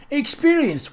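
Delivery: very high incredulous scoff; mock the very idea; scornful laugh. Squire scoffs at the vicar's claim, very high and derisive. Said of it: "Thornton exploded into a laugh".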